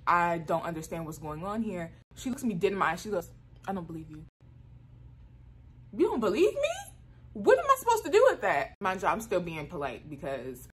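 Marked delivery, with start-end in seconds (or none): 3.7-4.2 low, quiet disbelief; understated, lowered retort; retold dismissive principal. Principal's retort, then echoed in outrage. 5.9-8.8 high, loud indignant echo; exasperated, high-pitched mock outrage; acted outraged disbelief.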